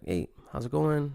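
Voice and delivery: mildly stern, held-back greeting; a little stern. Acted. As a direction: say hello with a touch of reserve. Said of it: "A little stern".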